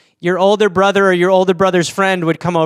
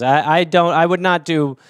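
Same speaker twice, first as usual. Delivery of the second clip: normal talking voice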